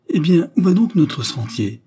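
high, loud startled exclamation; surprised cry. A narrator's reading. Girl stopping short on the path, high and loud in surprise. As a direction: blurt it out, caught off guard. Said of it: "elle poussa un cri de surprise".

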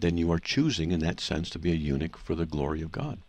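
usual speaking voice

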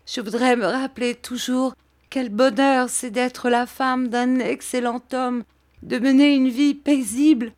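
high, tearful desperate appeal; acted anguish rising to a cry; anguished pleading. A narrator's reading. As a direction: beg for help, pitched well up. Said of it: "cet appel suppliant, qui dans son angoisse".

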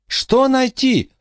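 put-on high girl's voice, slow yell; shouting over wind. A narrator's reading. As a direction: drag the yell out over the storm. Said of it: "прокричала Эмма".

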